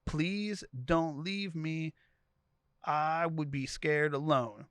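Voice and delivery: imitating crying. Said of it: "she starts crying".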